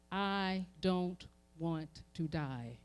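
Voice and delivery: ordinary talk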